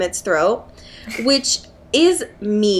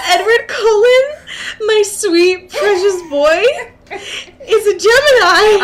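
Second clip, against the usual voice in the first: higher, louder, laughing fond gush; gushing reveal; 10 semitones higher, 5 dB louder than usual. Acted. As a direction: gush over him like a proud fan. Gushing reveal of star sign.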